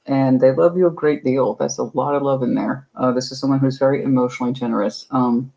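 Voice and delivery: ordinary delivery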